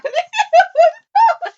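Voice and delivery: loud, high shrieking laugh; hard laughter. Real, not acted. Host cracking up at the vanilla claim, shrieking laughter. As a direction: burst out in helpless high laughter. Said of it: "I'm laughing so hard".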